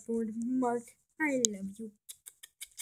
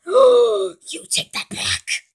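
slow, loud, hammed-up indignation; offended outburst; 8 semitones higher, 10 dB louder, much slower than usual. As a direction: gasp then demand it back. Offended gasp retort.

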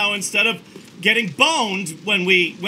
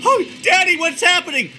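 whiny Slinky voice; 9 semitones higher, 3 dB louder than usual. Pretend. Voicing the Slinky character.

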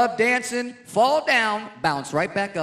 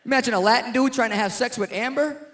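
ordinary talk

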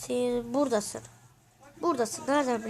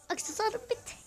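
mocking singsong; 9 semitones higher, 6 dB quieter than usual. Mocking friend in a lost round.

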